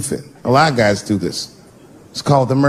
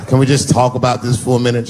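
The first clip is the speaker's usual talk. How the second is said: low seductive coaxing; 3 semitones lower than usual. Acted. Husband sweet-talking wife.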